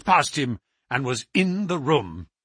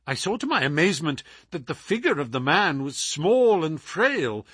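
plain reading voice

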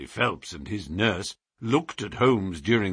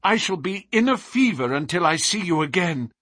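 higher, keyed-up exclamation; anxious cry; 8 semitones higher, 4 dB louder than usual. A narrator's reading. Client left waiting for the detective's return, anxiously crying out. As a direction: cry out with pent-up suspense. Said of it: "cried the diplomatist".

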